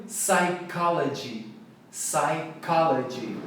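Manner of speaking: "Psychology" slowly as sigh-KOL-uh-jee; stress on second syllable. Shown as a demonstration. Teacher models for learners, slow "Psychology" stressed on KOL. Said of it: "Sílaba tônica é o K".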